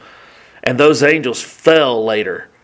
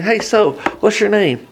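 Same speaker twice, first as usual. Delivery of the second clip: higher, breezy passer-by hello; casual greeting; 6 semitones higher than usual. Retold or imitated. Random person at a farmer's market, breezy and higher. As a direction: toss it off like a friendly stranger. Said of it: "a random person walking and saying".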